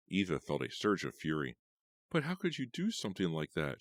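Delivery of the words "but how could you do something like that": higher, indignant shout; angry shout. A narrator's reading. Eva shouts at Tanya, higher indignant voice. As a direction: shout it at her. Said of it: "she shouted at Tanya".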